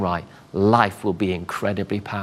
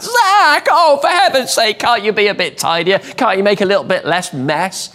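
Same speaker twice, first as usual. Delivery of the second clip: much higher and louder mock scolding of the boy; suddenly fed up with the mess on his clean floor; exasperated outburst; 9 semitones higher, 3 dB louder than usual. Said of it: "stuff rises in my heart".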